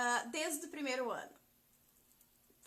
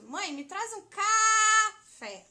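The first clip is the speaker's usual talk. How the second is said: slow, high, loud call with café drawn out; yelling for mom; 8 semitones higher, 14 dB louder, much slower than usual. Retold or imitated. Teacher mimicking calling her mother for coffee, shouted. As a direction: yell it to mom in the kitchen. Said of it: "eu não grito".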